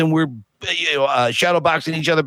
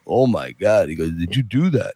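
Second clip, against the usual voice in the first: low, mumbling Stallone impression; 8 semitones lower than usual. Retelling Stallone seeing painting.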